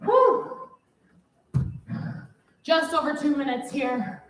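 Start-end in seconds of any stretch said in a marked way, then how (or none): 0.0-0.7 relieved whew. Real, not acted. Exhale after finishing the set.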